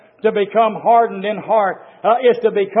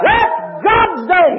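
That's God's day shouted high and slow; preaching shout; 9 semitones higher, 4 dB louder, slower than usual. Acted. Repeated line, shouted higher.

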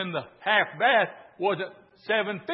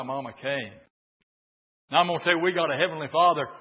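normal talking voice

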